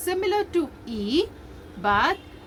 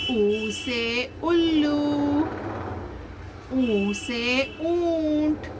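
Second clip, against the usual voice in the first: slow, drawn-out revision drill; measured teacher's recital with long vowels; slow recitation; slower than usual. Shown as a demonstration. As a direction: stretch each letter and word evenly for children.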